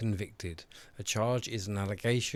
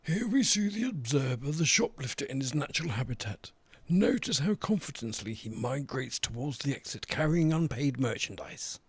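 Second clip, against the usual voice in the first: hushed, higher wildlife documentary narrator; nature documentary; 4 semitones higher than usual. Pretend. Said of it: "like a wildlife documentary".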